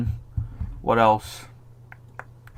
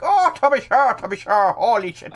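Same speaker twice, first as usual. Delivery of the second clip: mock Irish accent; 9 semitones higher than usual. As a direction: burst into exaggerated Irish. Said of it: "I speak normally, and then every now and again".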